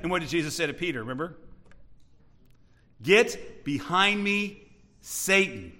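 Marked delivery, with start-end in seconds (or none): 3.0-5.7 drawn-out, heavily spaced command; slow rebuke. Acted. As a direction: stretch GET and BEHIND, pause before SATAN. Jesus rebuking Peter, first take.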